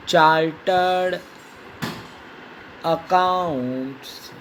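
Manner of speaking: slow dictation with long pauses; dictating. Shown as a demonstration. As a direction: give each example slowly for writing. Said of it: "नई line में लिखो". (रुक-रुककर लिखवाना)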